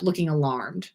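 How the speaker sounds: plain reading voice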